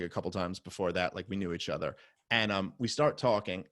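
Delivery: ordinary talk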